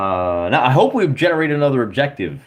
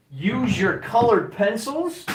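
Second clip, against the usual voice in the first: much higher, slower teasing repeat of the line; high mock voice; 11 semitones higher, slower than usual. Streamer mockingly echoing a viewer's tip in a high voice. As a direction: parrot the advice back in a high, slowed put-on voice.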